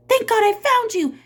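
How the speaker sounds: high, loud breathless relief; relieved cry. Acted. Miss Catherine finding Sam at the lakefront, high and loud. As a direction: rush to him in relief.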